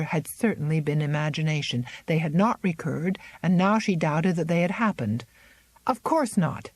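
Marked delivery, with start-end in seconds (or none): 5.9-6.7 a touch of angry denial, higher; mild anger. Acted. Miranda denying the fear to Zélie, mildly angry. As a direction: snap out a defensive denial. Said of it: "she finished angrily".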